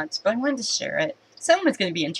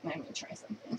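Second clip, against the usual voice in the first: whispered to herself; 2 semitones higher, 17 dB quieter, faster than usual. Real, not acted. Repeats line, second take whispered.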